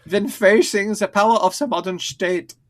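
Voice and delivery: high, loud hammed-up German accent. Shown as a demonstration. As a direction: redo the line with a thick German accent. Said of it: "I didn't stop my German accent".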